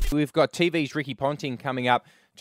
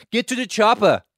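a much higher and louder mock action-hero bark; Schwarzenegger impression; 10 semitones higher, 9 dB louder than usual. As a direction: shout it like a cartoon Arnie. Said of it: "I won't even try one".

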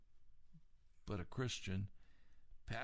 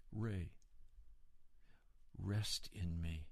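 slow, gentle and low; tender divine reassurance, slowed; God speaking kindly to Ray, gentle and slow; 3 semitones lower, 5 dB quieter, slower than usual. Retold or imitated. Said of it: "in a very kind and gentle voice".

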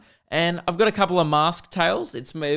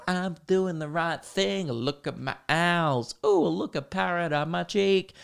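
slow, soft, singsong show-off voice; mockingly twee, drawn-out strut; funky mask wearer; 4 semitones higher, 3 dB quieter, slower than usual. Funky mask wearer walking down the street, mock precious.

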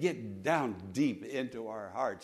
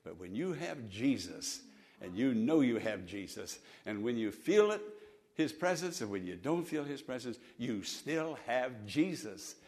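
softer, lower, tender close; soft reassurance; 4 semitones lower, 5 dB quieter than usual. Acted. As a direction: comfort the doubting listener. Quiet comforting close.

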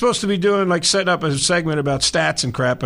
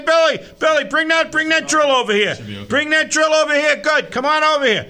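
lightly voiced bossy foreman, higher; mock foreman; 9 semitones higher than usual. Host playing a foreman calling the repair crew, mock bossy. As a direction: bark orders like a gruff work boss, playfully.

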